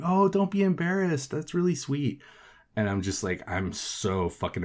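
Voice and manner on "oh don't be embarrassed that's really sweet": higher, lightly voiced consoling girl; mock sweet girl voice. Coworker comforting the embarrassed narrator, in a higher mock girl voice. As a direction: play her gently letting him down.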